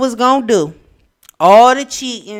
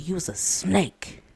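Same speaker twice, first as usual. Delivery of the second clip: drawn-out, hushed whisper; slow whispered accusation; whispered quote; 13 dB quieter than usual. Retold or imitated. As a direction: whisper it slowly, quoting someone's damning verdict.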